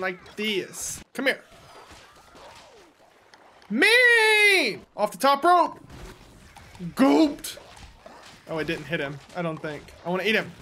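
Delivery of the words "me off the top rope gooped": high, loud hype calls; excited shouts. Real, not acted. Streamer calling out his big play, high and loud. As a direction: shout it like a wrestler diving.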